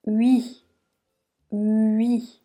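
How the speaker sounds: "Hui" glides from a U shape into an I; isolated hui sound. Shown as a demonstration. Teacher to learner isolating the sound, gliding U into I. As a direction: lips rounded for U, then slide to I on "Hui". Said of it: "on part comme si on allait prononcer un U, mais on finit par prononcer un I".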